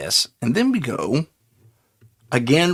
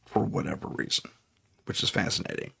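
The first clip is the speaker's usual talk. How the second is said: whispering; 4 semitones lower, 10 dB quieter, faster than usual. Real, not acted.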